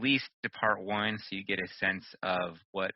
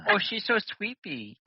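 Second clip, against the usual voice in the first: teasing; 12 semitones higher than usual. Real, not acted. Teasing a yawning cohost.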